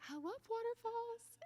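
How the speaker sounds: very high-pitched gush of delight; giddy schoolgirl. Retold or imitated. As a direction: squeal it like a thrilled little schoolgirl. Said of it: "I'm a giddy little schoolgirl".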